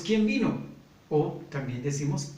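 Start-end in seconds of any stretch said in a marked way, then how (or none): 0.0-0.6 quick, lifted everyday "¿Quién vino?" with the fall on vino; natural everyday question. Retold or imitated. Teacher acting a family member hearing the front door, natural question. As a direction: ask it as if you just heard the door open.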